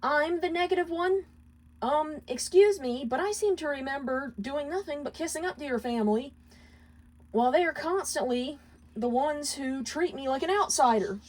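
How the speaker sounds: hurt husband pointing out the unfairness; indignant husband. Acted. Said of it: "Moxie pointed out".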